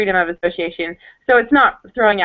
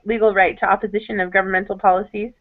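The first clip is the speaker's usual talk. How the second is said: ordinary delivery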